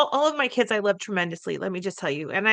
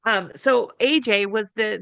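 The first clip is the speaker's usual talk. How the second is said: ordinary delivery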